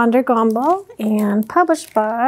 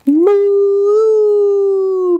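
drawn-out moo; 5 semitones higher, 3 dB louder than usual. Acted. Greeting a pizza cow.

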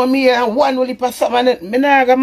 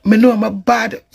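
normal talking voice

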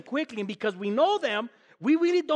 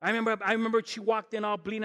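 usual speaking voice

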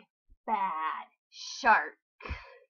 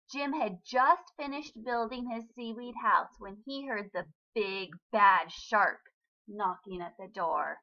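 ordinary delivery

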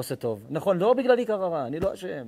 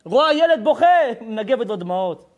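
preaching at full force; 6 semitones higher, 8 dB louder than usual. Acted. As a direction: thunder it from the pulpit. Rabbi preaching hard to crowd.

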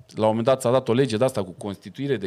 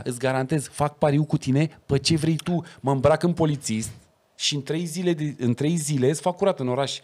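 ordinary delivery

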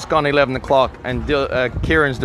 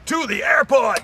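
excited shout; 9 semitones higher than usual. Real, not acted. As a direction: burst out with sudden energy. Sudden rallying cry to leave.

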